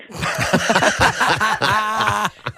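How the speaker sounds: a real, open burst of laughter; hearty laugh. Real, not acted. Guest laughing at her orthopedic shoes joke, real hearty laugh. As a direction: laugh freely at the punchline.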